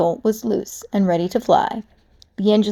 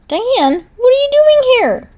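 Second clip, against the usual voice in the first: shocked; 5 dB louder than usual. Acted. Piranha suddenly sees Diane.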